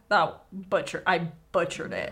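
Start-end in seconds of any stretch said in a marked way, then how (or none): none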